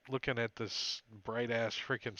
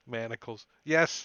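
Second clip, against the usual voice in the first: usual speaking voice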